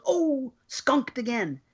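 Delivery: slow, drawn-out disappointed "Ooh"; mock disappointed drawl; drawn-out kid quote. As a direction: stretch it out like a letdown kid. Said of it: "he opens the mailbox and he goes".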